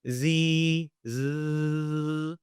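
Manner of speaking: z buzzed long and steady; held z sound. Shown as a demonstration. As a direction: hold the z as a long buzz. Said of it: "When you say".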